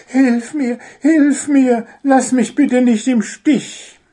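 loud, high pleading little bird; high-pitched, desperate begging; panicked wailing. A narrator's reading. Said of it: "jammerte er".

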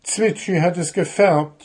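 high, mildly whining gnome; a touch of whining, high; moaning. Acted. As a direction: complain with a mild whine. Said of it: "jammerte Puh".

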